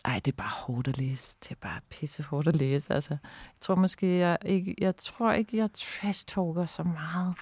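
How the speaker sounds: subdued, hesitant admission under the breath; hushed confession. Real, not acted. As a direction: say it quietly, half to yourself. Shaken after reading own text.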